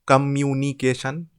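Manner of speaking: communication in five even beats; level, measured syllables in communication. Shown as a demonstration. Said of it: "Five syllables".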